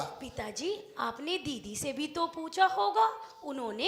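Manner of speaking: low, hushed enquiry; quiet, careful wheedling; soft coaxing. Acted. Coaxing father-in-law after the news.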